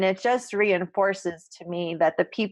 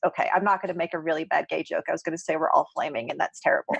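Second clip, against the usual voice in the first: quick aside; 5 semitones lower, much faster than usual. Real, not acted. Quick self-interrupting joke aside.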